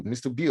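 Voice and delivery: "Beau" misread as "byoo". Shown as a demonstration. Parent addressing the man by misread name, "Beau" said "byoo". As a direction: rhyme "Beau" with "few". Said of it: "I just said Beau".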